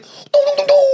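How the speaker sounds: high-pitched booming dongs; imitated sound effect. Comedian mimicking the gig's sound effects, high mock dongs. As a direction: dong out a comic sound effect. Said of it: "The sound effects".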